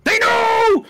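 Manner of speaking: loud, high alarm yell; shouting. Acted. Exposed lemonade seller warning hidden accomplices, shouting. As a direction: shout a warning far into the trees. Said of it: "as you hear him shout this".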